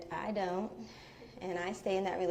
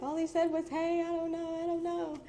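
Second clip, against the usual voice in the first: high, teary pleading through tears; plead, half-crying, to friends; retold crying; 9 semitones higher than usual. Showing friends the text, retelling her upset, high and teary. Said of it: "tears are falling now".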